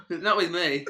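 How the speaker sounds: loud, high, through laughter; laughing. Real, not acted. Teased about what he's been up to, laughing. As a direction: protest while laughing.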